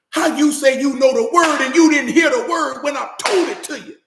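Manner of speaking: high, loud incredulous shout; retold shout. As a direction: yell it at him in disbelief.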